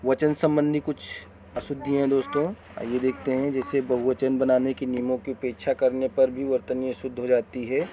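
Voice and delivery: ordinary talk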